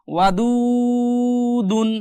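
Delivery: ওয়াদুদুন slowly recited with the middle দু held long; slow recited demo. Shown as a demonstration. Teacher reciting ওয়াদুদুন for students, slow chanted demo. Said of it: "দাল ওয়া পেশ দু".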